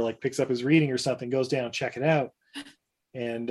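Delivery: usual speaking voice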